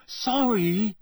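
high-pitched, exasperated apology; impatient. A narrator's reading. Harry apologising to touchy Myrtle, impatiently. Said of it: "he said impatiently".

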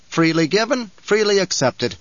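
lightly voiced magnanimous Kynes; gracious Kynes.

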